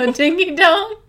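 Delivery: high disbelieving question; incredulous. Real, not acted. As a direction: squeak it in disbelief. Incredulous repeat of snack name.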